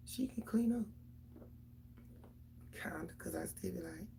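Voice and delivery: whispered confiding aside. Real, not acted. A mother confiding her daughter's cleaning to viewers, whispered. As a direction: let it out as a hushed secret.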